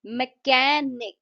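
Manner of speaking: Mechanic slow, with a loud, long कै in the middle; stress on KAN. Shown as a demonstration. Teacher demonstrating the stress mark, mechanic stressed hard on कै. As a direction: punch and stretch कै in mechanic. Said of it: "कै वाला जो sound है".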